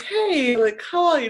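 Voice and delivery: high-pitched, chirpy mock-oblivious hello; cheery greeting. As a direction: act out a bright, unsuspecting greeting. Obliviously greets boyfriend before being dumped.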